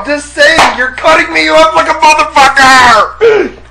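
annoyed shout. Real, not acted. Complains co-host keeps interrupting.

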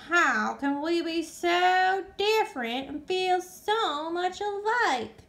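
high, slow wondering; musing. Acted. Flitter wondering about friendship, musing. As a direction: ponder aloud. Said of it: "mused Flitter".

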